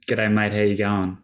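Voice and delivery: Australian greeting, relaxed and broad; Australian accent. Shown as a demonstration. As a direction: say it like a laid-back Aussie. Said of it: "the thing I often say is".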